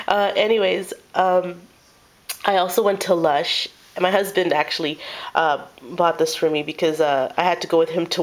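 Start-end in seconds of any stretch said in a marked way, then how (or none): none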